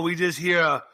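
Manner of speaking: normal talking voice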